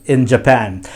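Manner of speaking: ordinary delivery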